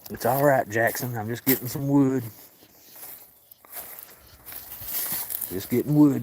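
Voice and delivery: lifted sing-song comfort to a pet; reassuring pet talk. Real, not acted. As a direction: reassure the cat he's only fetching wood. Reassuring the cat Jackson.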